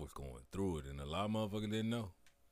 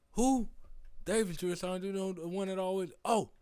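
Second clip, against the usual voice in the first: high, loud mock sergeant voice; drunk drill sergeant; 12 semitones higher, 8 dB louder than usual. Drunk drill sergeant summoning a private to call cadence, loud.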